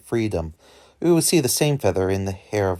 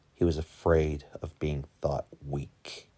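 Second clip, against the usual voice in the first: usual speaking voice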